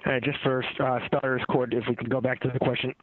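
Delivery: phone line audio; on speakerphone. Real, not acted. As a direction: caller heard over the conference phone. Next question comes from the line.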